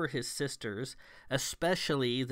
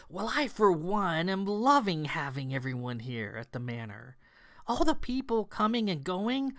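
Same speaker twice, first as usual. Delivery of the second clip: gushing excitement; 8 semitones higher than usual. A narrator's reading. Kat gushing to the hostile sisters.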